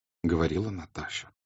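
plain reading voice

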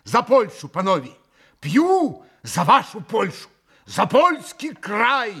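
loud, high, fervent toast; восклицание. A narrator's reading. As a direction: proclaim the toast with mounting enthusiasm. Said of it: "воскликнул Митя". (восторженный громкий тост)

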